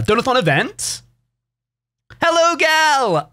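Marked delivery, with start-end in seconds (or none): 2.2-3.3 high, drawn-out hello; squealed greeting to a friend; excited greeting. Real, not acted. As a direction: call out a slow, high-pitched hello. Calls a greeting mid-sentence.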